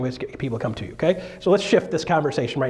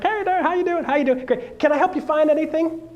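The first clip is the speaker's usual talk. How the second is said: much higher, chirpy clerk greeting; eager clerk greeting customer; pushy store clerk; 12 semitones higher than usual. Retold or imitated. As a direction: greet like an overeager salesman. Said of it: "he's like".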